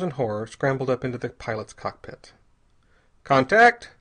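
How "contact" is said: loud, high, sharp order; sharp shouted call; snapped command. A narrator's reading. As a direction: snap it out like a pilot's command. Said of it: "he snapped".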